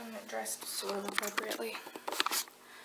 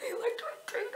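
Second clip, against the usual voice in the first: laughing; 13 semitones higher than usual. Real, not acted. Laughing and crying in disbelief.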